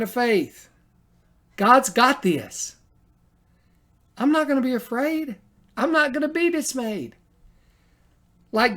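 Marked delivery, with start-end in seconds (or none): 1.6-7.2 fervent declaration. Acted. Preacher declaring faith to the church, fervent. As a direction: proclaim your faith boldly.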